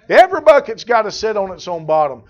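preaching it. Acted. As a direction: drive the saying home from the pulpit. Preacher repeats the saying.

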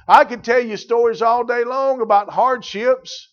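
fiery preaching. Acted. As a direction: preach it hard to the pews. Preaching at full force.

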